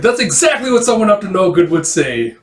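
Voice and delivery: high, loud accusation; accusing retort. Acted. Suspicious player answering the cheat's denial, loud accusing retort. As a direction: throw the excuse straight back. Acted accusation in dice game.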